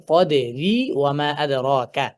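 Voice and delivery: slow chanted কদরি ওয়া মা আদরাকা; recitation. Shown as a demonstration. Teacher reciting Surah Qadr, slow recitation. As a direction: recite it slowly, drawn out. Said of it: "এখানে আমরা দেখতে পাচ্ছি".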